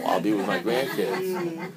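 slow, chuckling aside about the grandkids; laughing to himself. Retold or imitated. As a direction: savour it with a little laugh. Inner reply, laughing contentedly.

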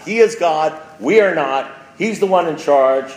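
loud, high, fervent preaching; a speaker on fire at the podium; preaching at full force. Acted. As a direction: hammer the message home to the room. Continues the sermon climax.

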